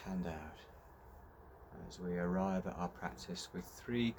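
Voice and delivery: measured meditation voice with long pauses; guided meditation. Real, not acted. Teacher leading everyone through fifteen minutes of breathing, guided meditation. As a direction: guide listeners breath by breath, leaving long silences.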